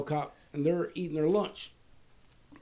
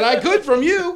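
laughing taunt; 11 semitones higher, 12 dB louder, slower than usual. Retold or imitated. Taunting the huffing security guard.

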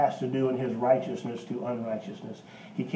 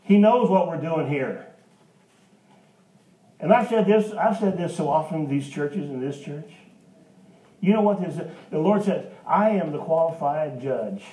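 raised, ringing pulpit voice; preaching at full force; 4 semitones higher, 6 dB louder than usual. Real, not acted. Preacher lifting his voice to the church, loud and ringing. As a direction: preach it out to the congregation.

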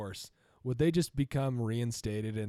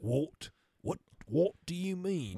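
put-on British accent; 3 semitones higher, slower than usual. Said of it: "haven't you heard how I speak".

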